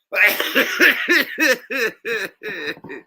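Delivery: loud, high rolling laughter; high cackling laugh. Real, not acted. Still cracking up at the clip, high cackling. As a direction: cackle uncontrollably.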